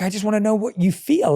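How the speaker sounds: high pleading plea to a closed-off partner; frustrated partner pleading. Retold or imitated. Partner begging the other to share feelings, high and pleading. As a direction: beg them to open up. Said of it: "I'm just like".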